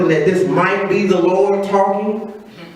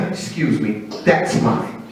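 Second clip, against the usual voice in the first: lightly voiced thief, low and matter-of-fact; mock casual taker; 9 semitones lower, 4 dB quieter than usual. Raider taking the farmer's harvest, mock casual. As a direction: drop low for a cool, understated claim. Acted crop thief stepping in.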